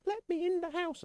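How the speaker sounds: very high, squeaky cat voice; voicing the cat. Streamer voices the cat demanding entry, squeaky high. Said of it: "is like".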